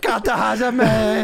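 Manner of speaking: mock excited call. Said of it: "We all were like".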